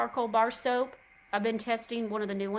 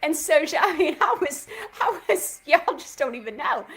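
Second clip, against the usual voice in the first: laughing gush; 3 semitones higher, 9 dB louder than usual. Real, not acted. Gushing over the super spray, laughing.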